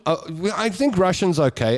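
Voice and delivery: normal talking voice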